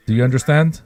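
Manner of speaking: usual speaking voice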